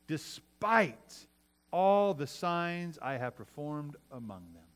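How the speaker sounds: slow, drawn out with heavy pauses; each "how long" booms, then settles slowly; God's stern rebuke. Retold or imitated. The Lord speaking to Moses about the people, stern rebuke.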